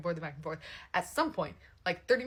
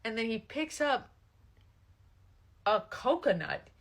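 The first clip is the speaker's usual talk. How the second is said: ordinary talk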